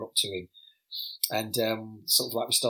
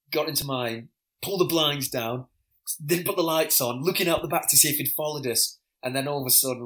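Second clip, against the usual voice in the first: ordinary talk, nothing marked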